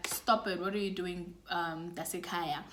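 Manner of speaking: hushed brotherly warning; imagined whisper. Retold or imitated. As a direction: talk her down quietly. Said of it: "your brother's whispering in your ear".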